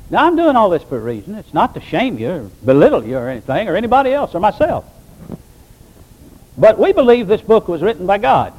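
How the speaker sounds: normal talking voice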